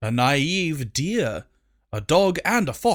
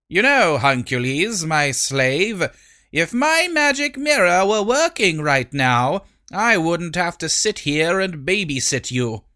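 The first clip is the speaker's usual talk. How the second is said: imperious queen; 4 semitones higher than usual. Acted. Queen to her slave.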